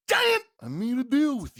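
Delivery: high, strained wrestler impression, punching "Giant"; Macho Man. Wrestler confronting his opponent over a deal, hammed-up outburst. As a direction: blurt "Giant" high and hard, then press on. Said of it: "The way you said giant".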